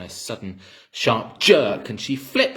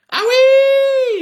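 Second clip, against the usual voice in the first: very high, loud hag's scream; old Grandma shrieking as she shoots up; screeching yelp; 14 dB louder than usual. A narrator's reading. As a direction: shriek like a startled witch. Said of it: "she screamed".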